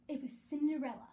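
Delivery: plain reading voice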